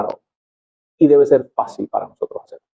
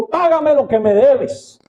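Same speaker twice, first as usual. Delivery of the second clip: higher, slow demand for payment; 10 semitones higher, much slower than usual. Acted. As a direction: squeeze the debt out of him. Servant chokes fellow servant.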